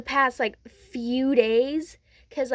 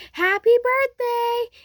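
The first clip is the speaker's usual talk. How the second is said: high, drawn-out, loud cheer; spring the surprise with a stretched, high call; surprise greeting; 11 semitones higher, 5 dB louder, slower than usual. Retold or imitated. You revealing Deku's surprise birthday party, excited call.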